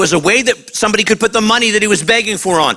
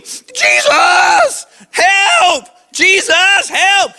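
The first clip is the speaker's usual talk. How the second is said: desperate shout; 10 semitones higher, much slower than usual. Retold or imitated. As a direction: scream for help, cracking with desperation. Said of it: "too busy shouting".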